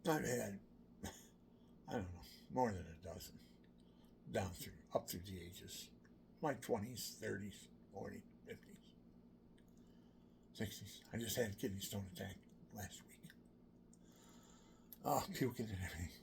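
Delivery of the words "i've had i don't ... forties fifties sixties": ordinary talk, nothing marked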